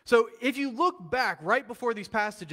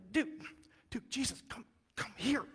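quietly pulling a friend aside, softer; lowered voice, urgent and private; hushed aside; 4 semitones higher, 6 dB quieter than usual. Retold or imitated. Peter pulls Jesus aside, hushed and pleading.

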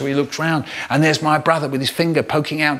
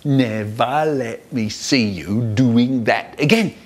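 lower, slow, word-by-word telling-off; mock dad scolding; 4 semitones lower, slower than usual. Both brothers in bed mimicking Dad, mock stern. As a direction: chant it like a stern father. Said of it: "we're both going".

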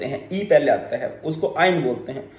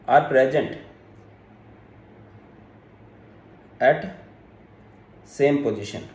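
short chunks with long gaps; dictation; much slower than usual. Real, not acted. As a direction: dictate a phrase at a time. Dictating a rule for note-taking.

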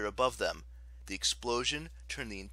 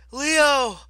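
very high, loud, urgent call; gasped shout; 15 dB louder than usual. A narrator's reading. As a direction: cry out with little breath left. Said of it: "Piper gasped for breath".

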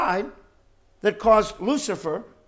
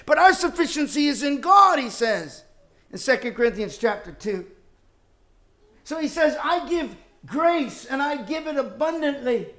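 higher, louder build through the list; rising pulpit fervour; preaching at full force; 7 semitones higher, 4 dB louder than usual. Acted. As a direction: lift each family member as you preach.

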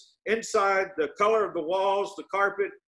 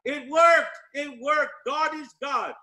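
jubilant exclamation; 9 semitones higher than usual. Retold or imitated. Assistant runs in to tell the prophet it worked, jubilant cry. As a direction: imitate an elated messenger, pitched very high. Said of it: "big smile on his face".

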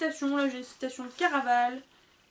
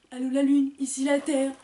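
teasing call; 9 dB louder than usual. Pretend. Joking about spaced-out friend.

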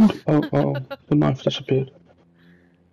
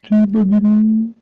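taunting singsong; 6 semitones higher, 4 dB louder, much slower than usual. Pretend. Taunting the other players.

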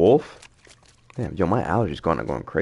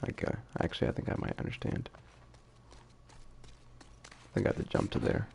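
soft, unvoiced mutter to self; quiet muttering; 2 semitones lower, 11 dB quieter, faster than usual. Real, not acted. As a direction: mumble it under your breath. Quietly working out a puzzle.

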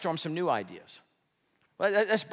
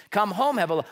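usual speaking voice

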